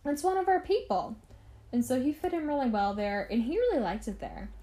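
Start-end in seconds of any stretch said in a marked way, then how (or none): 0.0-1.2 high-pitched excited welcome, imitated; delighted mock gushing. German settlers welcoming Andre, imitated delight in a high pitch. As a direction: gush like townsfolk claiming one of their own. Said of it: "so they loved him".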